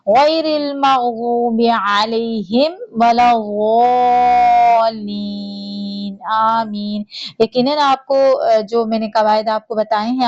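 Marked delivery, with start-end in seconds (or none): 0.1-7.0 slow, drawn-out melodic tajweed recitation; Quran recitation. Shown as a demonstration. Teacher reciting Surah Fatiha for students to follow, slow melodic chant. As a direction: chant each verse slowly with long held vowels.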